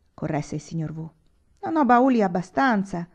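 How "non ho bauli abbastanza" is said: higher, louder mild moan; lady moaning to her husband about trunks; moaning complaint. Acted. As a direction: whimper about it like a fuss. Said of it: "gemette la signora".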